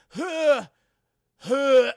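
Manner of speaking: two loud, sudden heaves; mock retching. Man feigning sickness at the roadside, loud retches. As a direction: heave it out loudly, twice. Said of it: "And he's like".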